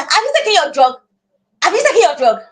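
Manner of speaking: sharp, frustrated nagging question. Shown as a demonstration. Parent nagging a child with sickle cell about drugs, frustrated. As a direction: fire it at the child like an attack. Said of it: "if you take it with a frustration".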